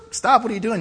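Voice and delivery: high, loud challenge; mock-officious woman calling out; alarmed door checker. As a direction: halt someone leaving the store. Acts the lady stopping him.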